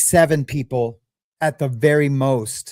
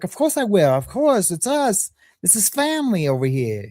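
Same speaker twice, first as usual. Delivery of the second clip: higher-pitched, badly faked Massachusetts accent; terrible put-on accent; 4 semitones higher than usual. Wife's sister agreeing to cover for family, in a bad Massachusetts accent. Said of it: "I know that's a terrible accent".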